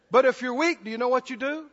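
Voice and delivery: ordinary talk, nothing marked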